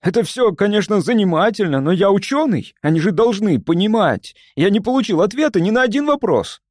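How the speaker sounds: high, heated complaint. A narrator's reading. Scientist protesting that he gets no answers, heated. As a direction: argue impulsively, pitch up throughout. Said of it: "горячился импульсивный Назарян".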